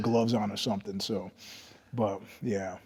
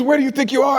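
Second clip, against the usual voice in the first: high, loud imitated question; incredulous challenge; 13 semitones higher, 7 dB louder, faster than usual. French shop woman challenging the lost traveller, incredulous. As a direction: demand it in mock disbelief, pitched up.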